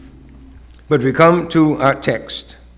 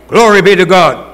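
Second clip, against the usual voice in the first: usual speaking voice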